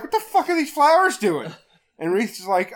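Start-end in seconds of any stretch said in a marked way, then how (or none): 0.0-1.5 higher, louder mock disbelief; mock bafflement. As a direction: imitate baffled kids, pitched up. Acted baffled group quote.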